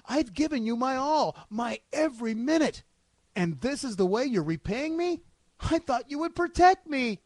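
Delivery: high, hurt and reproachful plea; wronged, pleading saint. Acted. Believer protesting to God in an audition reading, pleading.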